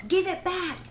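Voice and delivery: put-on high-pitched childish demand; mock shout. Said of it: "one of the wrestlers shouts".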